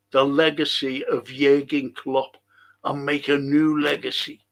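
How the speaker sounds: normal talking voice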